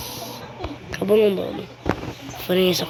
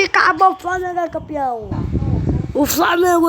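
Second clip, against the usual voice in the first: excited commentary; 7 semitones higher, faster than usual. Acted. Commentator calls the title win.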